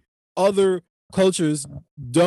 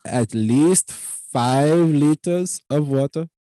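very slow, imitated Indian accent; 3 dB louder, much slower than usual. Clerk advising a customer on water, slow Indian accent. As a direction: draw each word out in an Indian accent.

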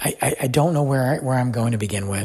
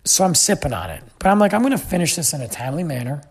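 ordinary talk, nothing marked

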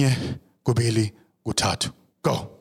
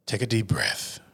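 ordinary talk

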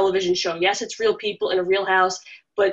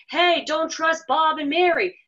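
mock wall yell; 7 semitones higher, slower than usual. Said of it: "fans that are standing outside yelling".

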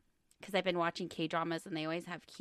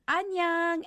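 cheery greeting; 10 semitones higher, 7 dB louder than usual. Retold or imitated. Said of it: "Arya came over and I was like".

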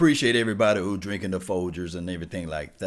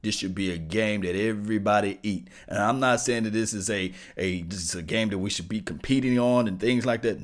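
usual speaking voice

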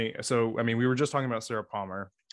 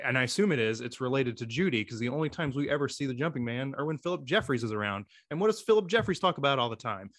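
ordinary talk

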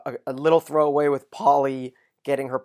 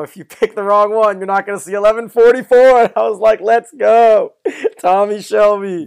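loud, high, thrilled fan retelling; excited hype; 8 semitones higher, 11 dB louder than usual. Retold or imitated. Host relishing Tommy's threat to the prisoner, loud and hyped. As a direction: build to a cheering, laughing shout. Building to favorite line, fan cheering.

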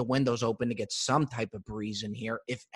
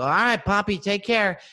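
calling out; 5 semitones higher, 5 dB louder than usual. Retold or imitated. Calling goodbye to the landscaper.

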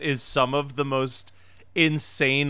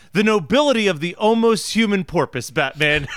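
pitched-up sidekick gravely marvelling; earnest Robin impression; 3 semitones higher, 4 dB louder than usual. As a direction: declaim it with mock-heroic earnestness. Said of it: "Robin just saying".

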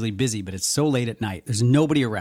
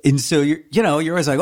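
ordinary talk, nothing marked

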